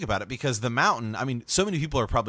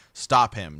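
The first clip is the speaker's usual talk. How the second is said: shouted command, read with restraint; a louder, lightly acted call to halt; mild shout; 7 dB louder than usual. A narrator's reading. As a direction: call it out as a narrator would. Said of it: "Ned shouted".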